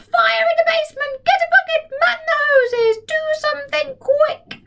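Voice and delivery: put-on very high screeching old woman; shouted. Grandma screams for the fire brigade, high screech. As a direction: screech it in panic.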